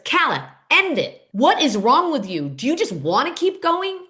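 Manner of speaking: high, emphatic retold outburst; losing it. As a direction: snap as if you've had enough. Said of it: "Steven loses it and says".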